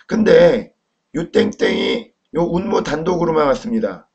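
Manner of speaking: ordinary talk, nothing marked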